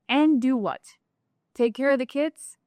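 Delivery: retold disbelieving comeback; indignant retort. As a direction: push back, as if the idea is absurd. Retorts to husband's suggestion.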